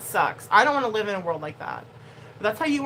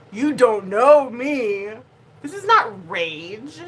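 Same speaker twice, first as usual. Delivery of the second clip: slow, drawn-out mock rage, louder on know; 3 semitones higher, 5 dB louder, much slower than usual. Streamer mocking a viewer who says she rages, slow mock rage. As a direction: ham up a fake rage to prove a point. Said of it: "You rage every stream".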